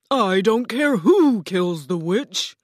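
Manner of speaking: lightly voiced stately enchantress announcing herself; grand Oz. A narrator's reading. Oz as green woman introduces herself, grandly slow. Oz dismisses objection.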